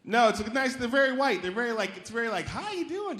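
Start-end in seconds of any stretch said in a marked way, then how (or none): 2.5-3.2 much higher, sing-song "How you doing?"; high Midwestern greeting. Retold or imitated. Comic mocking a Madison local's greeting. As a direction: mimic a friendly Midwesterner. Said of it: "very sort of Midwestern".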